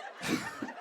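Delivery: squealing high laugh; high giggle. Real, not acted. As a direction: let out a shrill giggle. Laugh after sketch.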